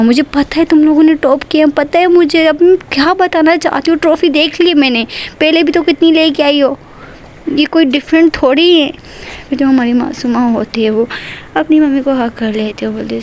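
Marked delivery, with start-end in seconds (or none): 0.0-8.9 higher, louder mother snapping at her daughter; irritated scolding. A narrator's reading. Mother scolding Masuma for fussing over trophies, higher and louder. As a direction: brush off the trophy talk in annoyance. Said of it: "उनको गुस्सा आ जाता है".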